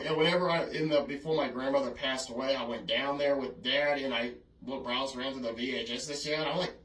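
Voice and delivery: ordinary talk, nothing marked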